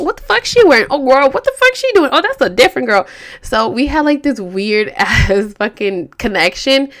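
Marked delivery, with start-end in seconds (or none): 0.0-3.1 high, loud retold gossip; mock gossipy outrage. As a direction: exclaim it like nosy friends spotting someone. Gossiping about another woman, retold.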